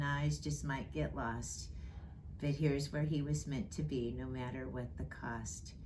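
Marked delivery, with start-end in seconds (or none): none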